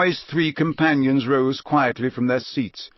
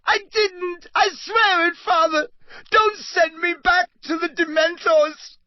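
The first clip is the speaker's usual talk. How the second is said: very high pleading shriek; desperate high shrieked begging; shrieking plea; 3 dB louder than usual. Acted. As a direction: beg in terror, voice up high. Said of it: "shrieked the boy".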